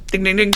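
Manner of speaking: high-pitched imitated bell dings; playful game-show style dinging; mock alarm bell. Mimics bell for first cuss word.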